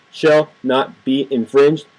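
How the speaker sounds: each word of shall not be infringed spaced out slowly; slow emphatic quote. Real, not acted. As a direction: spell it out word by word. Quoting the amendment slowly.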